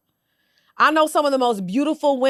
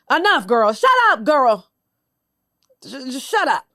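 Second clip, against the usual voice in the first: exasperated; 2 semitones higher, 4 dB louder than usual. Real, not acted. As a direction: cut her off, fed up and loud. Fed up with the self-pity.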